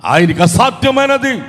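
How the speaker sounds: preacher's triumphant call; preaching proclamation. Real, not acted. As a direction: lift the voice and proclaim it. Preacher proclaims God's power.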